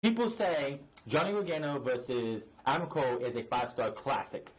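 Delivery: ordinary talk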